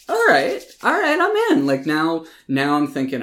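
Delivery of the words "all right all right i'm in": high, loud mock-excited reaction; pitched-up, eager buy-in; won over. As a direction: act out being suddenly hooked. Acts out own reaction to twist.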